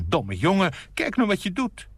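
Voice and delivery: high-pitched, nettled mother; cross scolding. A narrator's reading. Mother to her child after a mishap, crossly. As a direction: scold sharply, voice climbing. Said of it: "nijdig zei de moeder tegen het kind".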